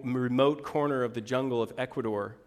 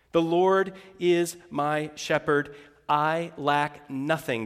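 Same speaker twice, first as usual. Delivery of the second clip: slow recitation; slower than usual. Acted. Slow recited psalm over music.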